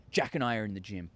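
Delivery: usual speaking voice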